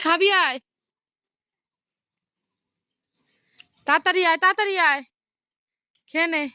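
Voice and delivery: calling out. Real, not acted.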